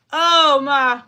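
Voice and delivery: startled. Real, not acted. Startled by the goose bite, loud. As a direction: react to the sting.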